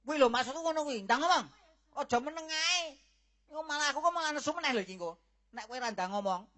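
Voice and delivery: loud, high, rushing threat; angry shout. Acted. Father threatens his daughter to speak now, angrily. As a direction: lose patience and snap.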